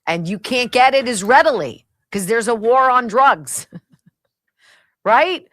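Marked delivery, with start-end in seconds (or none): 4.4-5.5 rising question through a laugh. Real, not acted. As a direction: laugh it out, louder. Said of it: "I'm laughing".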